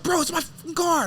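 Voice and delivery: high mock yell of the car owner; angry car owner. Car owner yelling at the man about his stolen car, high mock anger. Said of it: "He's like".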